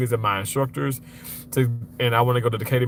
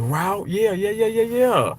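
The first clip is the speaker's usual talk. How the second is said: rising, higher and higher yeahs; excited yeahs; 8 semitones higher than usual. Real, not acted. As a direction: pile on the yeahs, climbing in pitch. Reacting to snake story.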